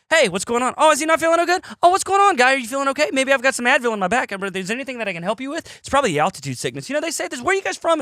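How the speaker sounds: high, bubbly mock voice of an overfriendly server; chatty bartender. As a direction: gush like a nosy, cheerful guy who won't stop talking.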